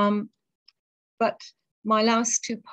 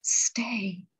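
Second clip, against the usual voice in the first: whispered. Acted. Quoted word in poem.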